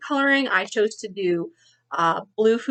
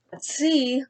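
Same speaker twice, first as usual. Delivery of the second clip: put-on lively read-aloud for children; story narration. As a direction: read it like a picture book to kids. Teacher reads picture book aloud.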